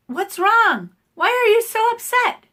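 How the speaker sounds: high, loud mock alarm; acted-out worried outburst, high and loud; imitating alarmed husband. Acts out worried husband on phone.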